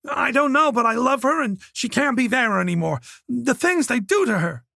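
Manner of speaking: put-on a cornered young man begging; Oliver pleading. A narrator's reading.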